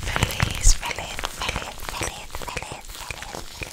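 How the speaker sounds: whispered name repeated as an ASMR trigger; repeated trigger word. Shown as a demonstration.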